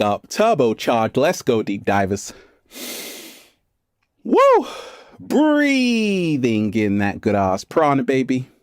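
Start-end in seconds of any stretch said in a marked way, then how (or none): 4.2-4.7 high loud whoop; excited whoop. Real, not acted. Hyping up viewers. 5.2-8.5 slow. Acted. Energy ritual.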